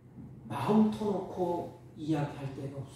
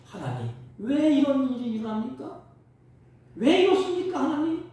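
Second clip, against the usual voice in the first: rising, higher, pleading cry to God; pleading complaint; 8 semitones higher, 4 dB louder than usual. Retold or imitated. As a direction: complain to God like a hurt child. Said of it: "이게 불평이 아닙니까?".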